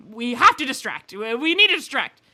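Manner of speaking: loud, frantic mock panic; mock urgent brand voice. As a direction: shout it like a panicked designer. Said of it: "where they're like".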